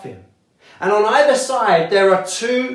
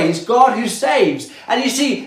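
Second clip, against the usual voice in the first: ordinary delivery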